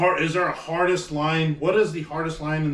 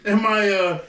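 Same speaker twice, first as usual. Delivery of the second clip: mock complaining; 6 semitones higher, 3 dB louder than usual. Mocking the guy complaining about good luck, whiny. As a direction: whine like a spoiled rich guy. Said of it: "It's like".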